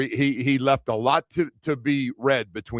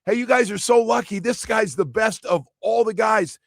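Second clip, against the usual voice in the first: high, loud hail across the street; calling out; 9 semitones higher, 3 dB louder than usual. Retold or imitated. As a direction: hype up the strangers on the bus. Calling out to tour bus.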